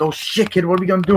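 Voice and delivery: high, frantic mock panic; panicked movie quote. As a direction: squeal it like a hero in trouble. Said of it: "it's like".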